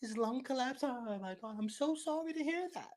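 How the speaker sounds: high, gushing fake condolence; mock shocked sympathy. Mocking a listener shocked by the son's death, high and gushing. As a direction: play the shocked listener, over the top. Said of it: "It's like".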